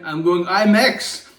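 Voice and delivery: slow, loud, rising on imax; slow excited buildup to imax; hyped rise. Pretend. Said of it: "first take, rising".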